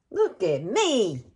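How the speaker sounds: goofy slowed "Look at me"; playful slow drawn-out. Real, not acted. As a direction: stretch it out for fun. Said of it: "I hope y'all don't think I'm crazy".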